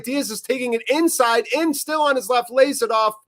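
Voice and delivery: quick, rising match-commentary read; play-by-play commentary. Acted. Fan streamer calls Diaz's break for viewers, excited commentary. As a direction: call the attack as it unfolds, building with the run.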